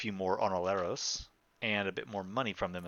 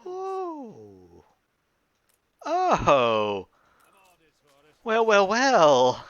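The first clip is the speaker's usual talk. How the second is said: high, loud, drawn-out "oh really"; gleeful surprise; 12 semitones higher, 12 dB louder, much slower than usual. Acted. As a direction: ham up a delighted fake surprise. Gloating over a rival's request.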